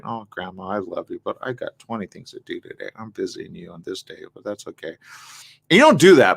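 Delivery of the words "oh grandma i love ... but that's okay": low, hushed inner complaint to grandma; unspoken thought. Retold or imitated. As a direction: think it softly, resigned but loving. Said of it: "in your head you do that".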